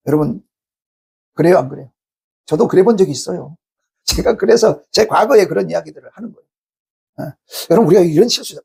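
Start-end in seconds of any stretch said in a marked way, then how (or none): none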